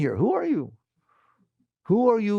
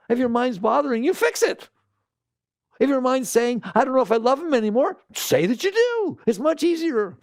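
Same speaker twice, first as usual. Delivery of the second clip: excited; 9 semitones higher than usual. Real, not acted. Lively high advice.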